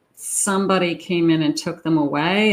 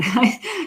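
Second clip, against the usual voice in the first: light laugh; 7 semitones higher than usual. Real, not acted. Laughs after the quote.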